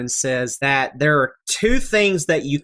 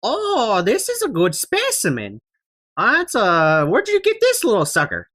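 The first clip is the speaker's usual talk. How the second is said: put-on high old woman voice, interested; old alchemist lady; 7 semitones higher than usual. Alchemist studies the head, high old woman voice. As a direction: inspect it like a curious old crone. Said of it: "and she goes".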